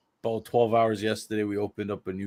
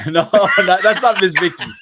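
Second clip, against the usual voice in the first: laughing through the words; higher, louder, laughing reply; 8 semitones higher, 8 dB louder than usual. Real, not acted. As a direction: giggle while correcting. Laughing at chips joke.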